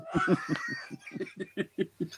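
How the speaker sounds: real laugh after the movie line; laughing shout. Real, not acted.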